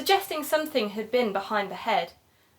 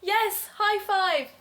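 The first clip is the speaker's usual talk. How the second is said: pleased high five; 11 semitones higher, much slower than usual. Real, not acted. Celebrating a found name.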